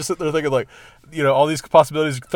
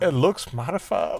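slow, soft mock Southern accent; drawn-out, quiet old Southern imitation; 6 dB quieter, much slower than usual. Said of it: "she has an old Southern accent".

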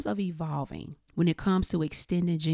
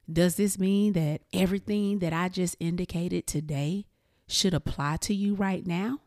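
normal talking voice

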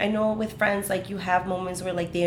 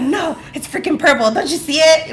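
higher, louder insistence it's purple; mock stubborn; 9 semitones higher, 8 dB louder than usual. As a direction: dig in and argue back. [name] stubbornly contradicting others.

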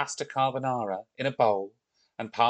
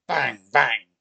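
two loud, punchy thumps; stomping sound words; 7 dB louder than usual. A narrator's reading. Narrator imitating the Viking's stamping feet, loud. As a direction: hit each word like a stamping foot.

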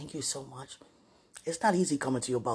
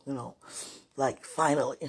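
usual speaking voice

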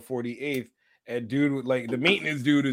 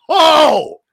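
high, loud hoot; mock-shocked "oh"; 11 semitones higher, 10 dB louder than usual. Reacting to the slavery comeback, in loud delighted shock. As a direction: crow at a devastating comeback.

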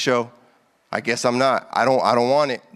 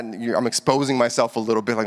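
ordinary talk, nothing marked

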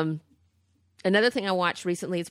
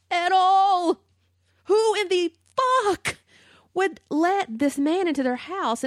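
louder and higher, drawn-out indignant rant; 12 semitones higher, 5 dB louder, slower than usual. Real, not acted. Ranting about parents letting him in, loud disbelief. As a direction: let the outrage spill over.

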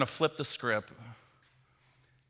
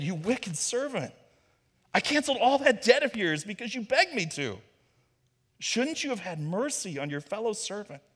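stern rebuke; 7 semitones higher than usual. Acted. Master rebukes the servant.

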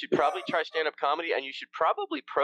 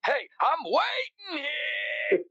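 much higher, slow whining; whiny New York complaint; 13 semitones higher, slower than usual. Retold or imitated. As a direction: drag out the complaint, NY style. New York bit continues.

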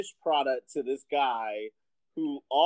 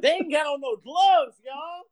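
loud, high-pitched public callout; mock-outraged holler to the crowd; announcing to everybody; 10 semitones higher, 6 dB louder than usual. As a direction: raise your voice so the whole shop hears. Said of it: "Let me say it to everybody".

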